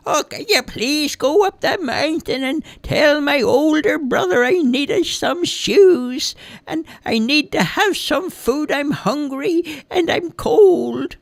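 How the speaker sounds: put-on high, cracked old man begging for help; old man pleading. A narrator's reading. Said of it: "discovered there was an old man".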